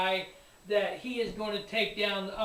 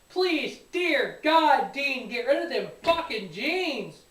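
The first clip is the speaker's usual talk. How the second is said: slow, loud, higher pleading; exasperated plea; 4 semitones higher, 6 dB louder, slower than usual. Real, not acted. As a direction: drag it out, begging heaven. Begging the wrestler to change.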